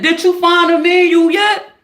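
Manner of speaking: wife just asking about the manual; mock normal question. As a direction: ask it like normal shit. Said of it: "Normal shit".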